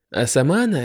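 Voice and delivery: ordinary delivery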